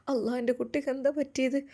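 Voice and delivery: high, soft wail of worry; acted tearful alarm; distressed mother. A narrator's reading. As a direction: plead softly as an anguished mother. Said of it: "ഉമ്മ ആകെ വിഷമിച്ചു".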